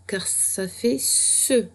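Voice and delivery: ce dit lentement, avec S; son ce. Shown as a demonstration. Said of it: "ce que". (ce articulé lentement)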